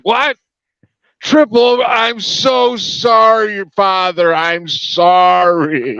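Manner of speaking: embarrassed apology. Retold or imitated. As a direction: stretch out a sheepish high sorry. Danny voiced apologising on the phone.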